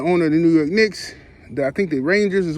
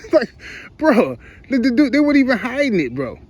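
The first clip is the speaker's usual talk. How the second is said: laughing; 7 semitones higher than usual. Real, not acted.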